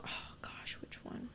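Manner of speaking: very quiet whisper to oneself; whispered deliberation. Real, not acted. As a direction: whisper it while weighing the choice. Torn choice, muttered to herself.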